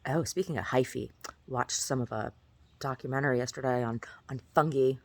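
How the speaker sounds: normal talking voice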